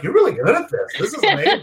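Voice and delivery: giggly, high-pitched stoned voice; laughing high mock praise; stoned self-praise. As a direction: gush at yourself while laughing. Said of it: "I was so baked that I was like".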